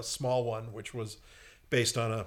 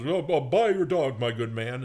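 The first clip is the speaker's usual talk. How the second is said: loud opening, settling down as a butler's offer; cartoon butler; 4 dB louder than usual. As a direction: play a butler making an offer. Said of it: "the butler goes out and says".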